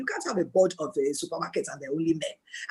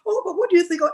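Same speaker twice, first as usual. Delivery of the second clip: mock casual suggestion; 10 semitones higher, 4 dB louder, faster than usual. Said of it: "So she very calmly said".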